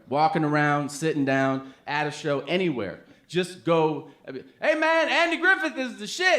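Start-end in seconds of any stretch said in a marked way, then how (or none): 4.6-6.4 high-pitched hyped-up greeting; hammed-up enthusiastic hail; mock fan call. Acted calling out to the comedian.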